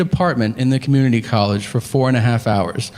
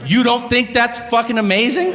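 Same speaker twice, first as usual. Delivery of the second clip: much higher, incredulous plea; exasperated rant; 8 semitones higher than usual. Retold or imitated. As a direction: demand he share the wonder. Ranting at indifferent waiter.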